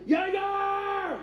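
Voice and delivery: high, drawn-out bar cheer; rowdy party yell. Acted. Mocking drinkers in a dive bar, rowdy high yell.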